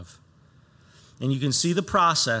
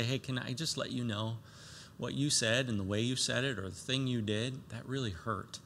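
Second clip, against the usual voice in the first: softly, telling a friend they hurt you; gentle quiet word; 3 semitones lower, 8 dB quieter than usual. Pretend. Believer gently telling a brother he hurt them, soft. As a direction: ease it out so it does not wound.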